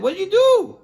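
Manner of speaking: high, loud incredulous cry; loud high-pitched mock disbelief; mocking exclamation. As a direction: call up in disbelief. Family laughing at servant.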